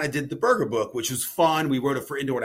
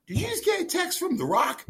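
retold astonishment.

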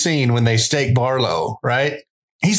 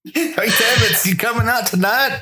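high Southern mock voice; mock Southern accent; 11 semitones higher than usual. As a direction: call out to a buddy in a hammed-up Southern accent. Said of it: "give them Southern accents".

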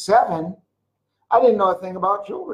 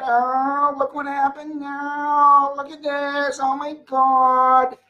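higher, mock whiny complainer; hammed-up complaining voice; whiner; 7 semitones higher than usual. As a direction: play a moaning whiner. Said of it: "You're either a whiner or a complainer".

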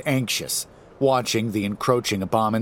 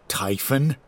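ordinary delivery

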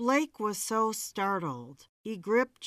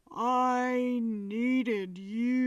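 slow, drawn-out lower plea; deeper low tone; 3 semitones higher, much slower than usual. A narrator's reading. Medium voices the ghost in a deeper tone. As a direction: drop to a deeper, heavier voice. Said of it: "to a deeper, low-pitched tone".

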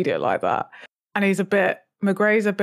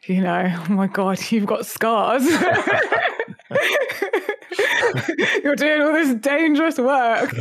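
proper uncle voice; 7 semitones higher than usual. Retold or imitated. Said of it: "which is like".

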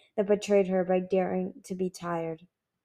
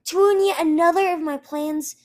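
higher, louder bitter accusation; spat; 8 semitones higher, 8 dB louder than usual. A narrator's reading. Sister accusing her brother of ruining plans, spitting anger. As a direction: throw the blame in his face. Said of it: "she spat".

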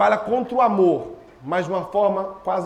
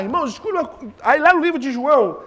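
usual speaking voice

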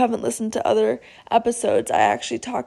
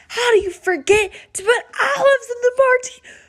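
much higher, squeaky mock outrage; mocking the owner; 12 semitones higher than usual. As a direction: parody his outraged question, pitched way up.

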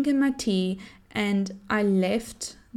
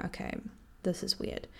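barely voiced worried inner thought; whispered thought; 11 dB quieter than usual. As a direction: whisper it to yourself, uneasy. Worried private thought on test.

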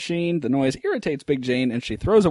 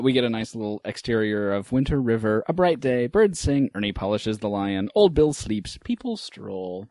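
ordinary talk, nothing marked

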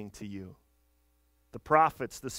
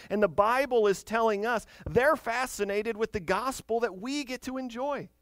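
rising excitement; 6 semitones higher, 5 dB louder than usual. Real, not acted. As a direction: voice climbs high and loud with wonder. Preacher building to excited peak.

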